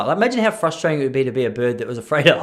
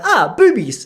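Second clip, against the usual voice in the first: said out loud like it's nothing, louder; too loud; 8 semitones higher, 6 dB louder, slower than usual. Retold or imitated. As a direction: blurt it out at full volume, totally casual. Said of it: "I said it at a volume".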